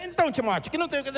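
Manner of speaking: mock-desperate, high wife voice chattering over the film; playing the pushy wife, hammed-up; imitating a desperate wife. Wife interrupting husband's film, mock desperate. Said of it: "e fala desesperado".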